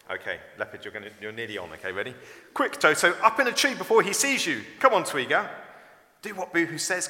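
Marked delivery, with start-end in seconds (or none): 2.5-5.5 high, loud, hurried call to friends; urgent warning. A narrator's reading. Friend urging Toto up a tree from leopard, urgent. 6.2-7.1 higher-pitched call. A narrator's reading. Twiga calling to Toto to obey Boohoo, urging. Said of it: "called Twiga".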